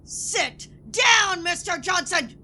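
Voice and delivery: ordinary talk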